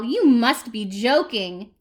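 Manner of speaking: loud, slow, disbelieving owl; incredulous. Acted. Owl turning down the cactus's hug, incredulous.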